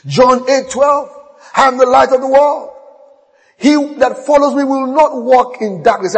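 loud, high preacher's proclamation; preaching at full force. Acted. Pastor proclaiming to his church, loud and high. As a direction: preach it out to the congregation.

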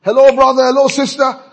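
high loud call for helpers; preacher playing a church worker calling for help. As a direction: call out for hands, hammed up. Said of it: "Someone we can call upon".

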